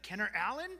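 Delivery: high, loud imitation of a scolding mom, hitting Allen; stress on Allen. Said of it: "it's the emphasis on Allen".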